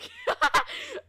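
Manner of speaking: real high-pitched laugh; high burst of laughter. Real, not acted. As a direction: crack up at own joke. Laughs at own moose joke.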